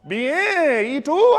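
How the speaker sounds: loud, high, excited reply; delighted customer. Retold or imitated. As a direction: imitate a thrilled Spanish speaker.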